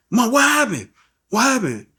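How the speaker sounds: high-pitched, pleading questions; urgent alarm. Retold or imitated. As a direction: demand an answer from your crying mother. Son urgently asks crying mother.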